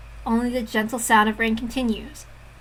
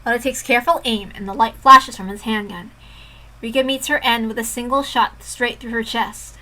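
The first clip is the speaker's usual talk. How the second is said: ordinary delivery, nothing marked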